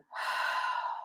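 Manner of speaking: a long, breathy exhaling sigh; demonstration sigh. Shown as a demonstration. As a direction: let the breath out audibly as a demo.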